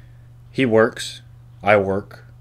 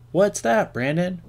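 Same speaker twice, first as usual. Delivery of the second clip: a lightly voiced imaginary friend asking, higher; imaginary second voice; 10 semitones higher, slower than usual. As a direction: ask it as a pretend second person, high and curious. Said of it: "That's me talking to myself".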